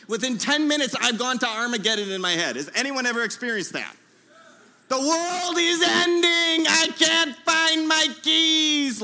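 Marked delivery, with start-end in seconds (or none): 4.9-9.0 higher, louder, slow mock-apocalyptic wail; mock panic. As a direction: ham up the end of the world over lost keys. Armageddon in my head over keys.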